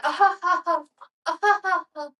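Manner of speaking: high, loud mock wailing; imitated weird crying. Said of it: "It's a very weird crying sound".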